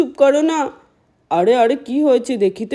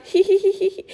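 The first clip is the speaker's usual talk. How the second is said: high little tee-hee giggle; mischievous giggle; 7 semitones higher, faster than usual. Acted. Child hiding in the penguin's house giggles to herself, high tee-hee. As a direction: giggle over your clever hiding spot.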